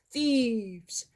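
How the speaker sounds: usual speaking voice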